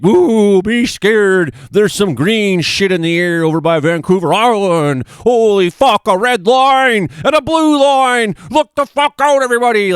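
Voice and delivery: high, loud hammed-up doom-crier; mock fearmonger. Mocking what the commenter thinks his forecasts sound like, hammed-up panic. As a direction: shriek fake panic like a sensational forecaster. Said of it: "Am I sitting around here being like".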